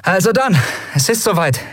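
a hint of forced confidence; Endriel, rallying. A narrator's reading. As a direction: rouse the crew with nervous energy. Endriel rallies crew.

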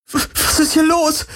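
very high, stammering panic; panicked, cracking squeal of a question. A narrator's reading. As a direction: stammer it in a breaking voice. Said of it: "Kirais Stimme überschlug sich".

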